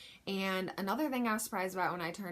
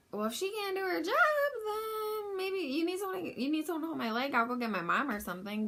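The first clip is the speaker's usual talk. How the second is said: much higher, fast, whiny mock complaint; exasperated inner thought; 10 semitones higher, 4 dB louder, faster than usual. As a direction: rattle it off, fed up and sarcastic. Her annoyed inner thought.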